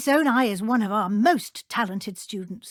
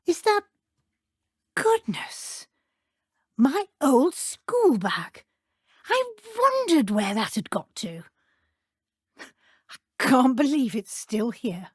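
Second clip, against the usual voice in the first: startled then fondly amazed; teacher stumbling on her old school bag; surprised delight. Acted.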